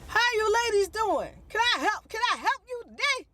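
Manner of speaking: high, loud, pushy car-salesman greeting; eager salesman. Retold or imitated. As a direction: play the overeager lot salesman, way up high. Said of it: "a man comes out and he's like".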